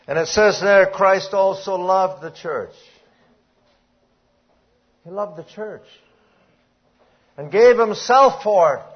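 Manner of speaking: high, loud fervent preaching; preaching at full force. Acted. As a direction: drive the point home to the congregation. Preaching on Ephesians.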